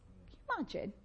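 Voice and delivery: softly, under the voice; hushed wonder. Real, not acted. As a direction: marvel at it quietly. First take, then exclaimed repeat.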